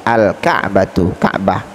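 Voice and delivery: normal talking voice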